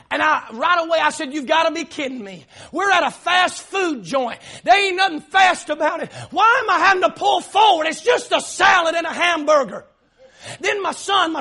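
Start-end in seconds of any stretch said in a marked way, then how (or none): 1.3-9.9 retold rant building higher and louder; exasperated rant. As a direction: act out an indignant complaint, pitch climbing. Acted rant at slow fast-food service.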